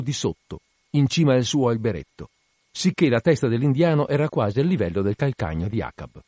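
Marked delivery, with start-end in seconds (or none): none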